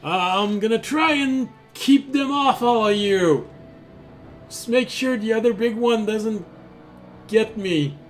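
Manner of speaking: game master voicing Arunak, high-pitched character voice. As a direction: lift the voice high for an anxious ally. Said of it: "He turns toward you, Fisher, and says".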